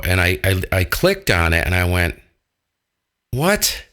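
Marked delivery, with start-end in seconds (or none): none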